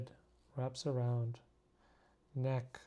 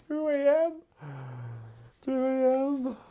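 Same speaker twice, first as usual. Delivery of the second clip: mock wail; 12 semitones higher, 9 dB louder than usual. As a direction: wail it high in playful exhaustion. Tired streamer wails the late hour.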